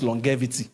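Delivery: "longevity" with a hard g, long-GHEV-ity; mispronunciation. Retold or imitated. Coach citing a fake-accent speaker's slip, "longevity" as long-GHEV-ity. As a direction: say "longevity" with g as in "get". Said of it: "I know you are mispronouncing it".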